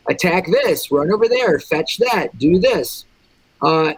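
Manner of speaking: ordinary talk, nothing marked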